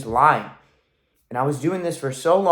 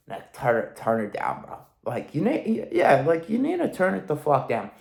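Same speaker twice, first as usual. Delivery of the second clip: imitating a killjoy; 4 dB quieter than usual. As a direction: hush him like a buzzkill friend, a bit mocking. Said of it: "who is not gonna be like".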